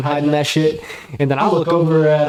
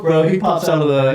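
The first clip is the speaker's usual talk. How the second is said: usual speaking voice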